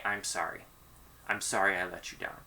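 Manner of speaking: soft and quiet, "I'm sorry" said plainly with nothing added; mean it, gently and quietly; sincere apology. Shown as a demonstration. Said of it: "when I just say".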